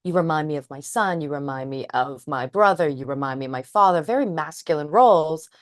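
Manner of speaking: usual speaking voice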